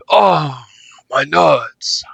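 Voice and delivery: hammed-up groin-kick pain; pained groan. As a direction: wheeze it out like you've been kicked. Character kicked in groin.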